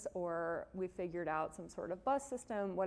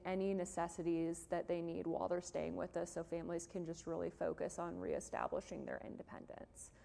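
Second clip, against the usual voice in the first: usual speaking voice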